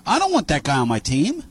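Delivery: normal talking voice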